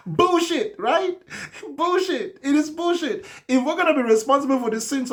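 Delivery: high-pitched excited calling out. Real, not acted. Host excitedly agreeing with point.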